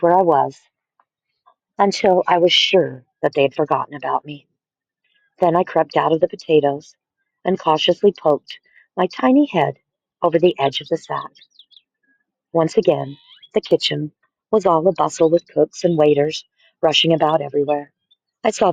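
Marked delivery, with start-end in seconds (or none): none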